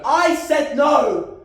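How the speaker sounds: slow, loud, drawn-out shout; shouting at his ex over the abortion; anguished shout. Acted. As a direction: hammer home each word.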